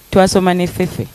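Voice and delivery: normal talking voice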